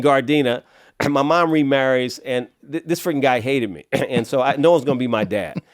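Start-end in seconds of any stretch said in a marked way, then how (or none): none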